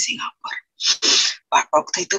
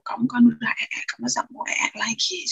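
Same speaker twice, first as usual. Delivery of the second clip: ordinary delivery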